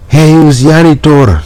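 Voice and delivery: high, loud call through the door; loud shout. Acted. Mailman shouting at the janitor. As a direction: shout at the janitor to let you in. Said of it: "with a loud voice shouts".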